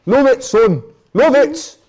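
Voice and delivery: mock captain yelling at a teammate; Hendo shouting. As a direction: bellow praise across the pitch like a captain. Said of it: "That's all you'd ever hear him saying".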